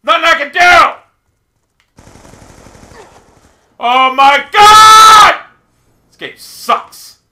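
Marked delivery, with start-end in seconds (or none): 0.0-5.4 loud, high outburst of rage; frustrated shout. Real, not acted. Gamer raging that nothing can be done, frustrated shout. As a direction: yell it in real exasperation, drawing out the last word. Player raging at failing game.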